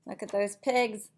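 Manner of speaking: ordinary delivery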